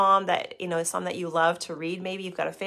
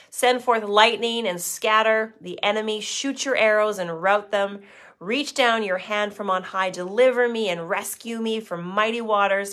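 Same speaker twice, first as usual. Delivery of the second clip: louder, pleading psalm reading; fervent scripture reading; 4 dB louder than usual. Acted. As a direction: cry the verses up to God. Reads psalm, pleading verses.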